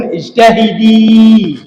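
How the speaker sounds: "اكتبي" stretched slowly, the final ي held long; drawn-out teaching demo. Shown as a demonstration. Teacher showing students the added ya, slow stretched demo. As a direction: spell out "اكتبي" slowly, holding the ياء. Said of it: "إذا أدخلت عليه الياء تقول".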